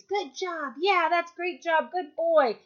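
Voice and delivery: high, slow, gushing praise for a dog; happy dog-praise voice; enthusiastic praise. Shown as a demonstration. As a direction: cheer the dog on, drawn out and bright. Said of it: "let your dog know through enthusiastic sounding vocalizations".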